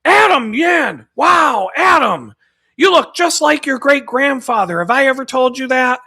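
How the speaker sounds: put-on high shouted name, slow loud gushing old voice; high loud delighted elderly lady; excited old lady. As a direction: greet him like you just noticed him. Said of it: "she'd be like".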